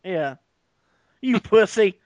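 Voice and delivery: usual speaking voice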